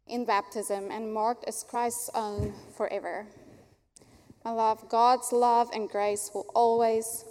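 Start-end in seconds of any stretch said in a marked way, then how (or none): none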